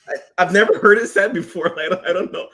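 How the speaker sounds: high, loud, laughing stammer; flustered excuse. Acted. Embarrassed colleague backtracking in a meeting, flustered and laughing. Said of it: "And you're like".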